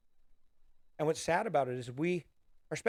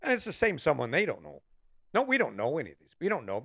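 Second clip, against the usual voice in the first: ordinary talk, nothing marked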